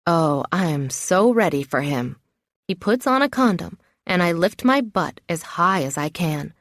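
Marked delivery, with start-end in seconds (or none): none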